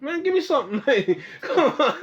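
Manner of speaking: high, laughing mock plea; imitating a laughing demand. Friend retells ordering a hot plate, laughing and high. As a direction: laugh through a pleading order. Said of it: "Told me".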